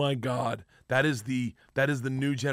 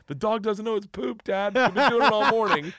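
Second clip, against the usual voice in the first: put-on higher-pitched kids tickled by their prank; gleeful kids; 7 semitones higher, 3 dB louder than usual. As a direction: imitate giggly children telling Dad. Kids gleefully answering their dad.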